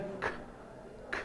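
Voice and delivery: ख said alone as a voiceless puff; just the ख burst, unvoiced; isolated consonant sound. Shown as a demonstration. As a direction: breathe out a bare ख with no vowel. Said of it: "surrounding element है ख".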